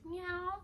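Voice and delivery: high-pitched little meow; cat meow. Pretend. Playful aside while vlogging, high cat meow. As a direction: mimic a cat, pitched well up. Imitates a cat mid-vlog.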